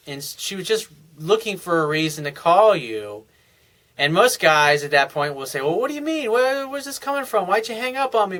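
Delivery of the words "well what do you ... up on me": whiny chasing guy. Retold or imitated. Mocking guys who start chasing.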